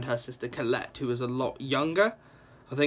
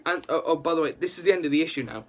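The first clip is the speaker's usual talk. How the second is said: ordinary talk, nothing marked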